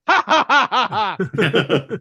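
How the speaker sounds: loud, high cackling laugh; hammed-up mocking guffaw; mock villain laugh. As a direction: laugh at him like a gloating baddie. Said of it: "And Wangler's like".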